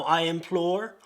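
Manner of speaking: rhythmic, theatrical verse reading; dramatic poem recital. Acted. Reading a drunken parody of The Raven, dramatic recital. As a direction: recite it with mock-gothic drama.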